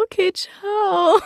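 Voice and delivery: high, very slow drawn-out ciao; mock awkward goodbye. Voice actor retelling her casting exit: high slow mock goodbye. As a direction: say goodbye to nobody, sheepishly. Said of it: "dann so".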